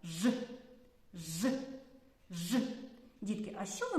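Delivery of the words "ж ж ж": Ж as a drawn-out buzzing consonant, no vowel; isolated sound Ж. Shown as a demonstration. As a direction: sustain Ж on its own, like "zhhh". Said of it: "Давайте разом його вимовимо".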